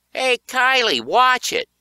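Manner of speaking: high, loud shout; calling out. A narrator's reading. Boy on roller skates yelling at Kai, high and loud. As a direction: yell a warning across the hall. Kid yelling while narrator is shoved.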